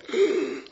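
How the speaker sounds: a sudden high gasp of fright; mock-scared intake of breath; sharp panicked gasp. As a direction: gasp as if caught red-handed. Panic at being found out.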